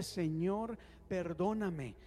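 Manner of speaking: oración pastoral que se vuelve más suave y baja hacia el final; oración guiada. Acted. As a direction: guiar a la iglesia en oración, cada vez más íntimo. Pastor leads congregation in prayer. (pastor orando en voz alta por la congregación, con recogimiento)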